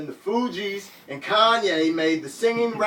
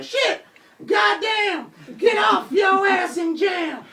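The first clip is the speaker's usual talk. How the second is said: crowd yelling; slower than usual. Retold or imitated. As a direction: scream it like the whole crowd is jamming.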